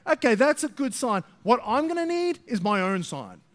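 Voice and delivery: lightly voiced skeptic asking for signs; hint of a whiny believer demanding proof; mock doubter. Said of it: "what we often do is we go".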